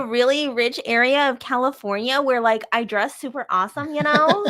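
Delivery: put-on Valley Girl with a rising tail; hammed-up Valley Girl uptalk; Valley Girl accent. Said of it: "the only accent I can do is the Valley Girl".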